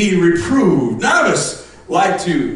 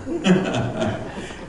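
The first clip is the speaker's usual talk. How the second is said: laughing; 9 semitones lower than usual. Retold or imitated. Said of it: "I'm like".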